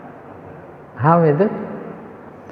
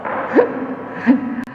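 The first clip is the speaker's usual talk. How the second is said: laughter; 10 semitones higher, 5 dB quieter than usual. Real, not acted. Laughing at the jamu joke.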